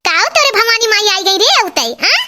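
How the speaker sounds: high-pitched indignant challenge; scolding. Acted. Mother-in-law confronting her daughter-in-law, indignant scolding. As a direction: demand an answer, pitch jumping up.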